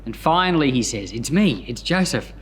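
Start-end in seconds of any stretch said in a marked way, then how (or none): none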